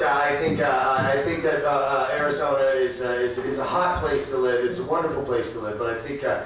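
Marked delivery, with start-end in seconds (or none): none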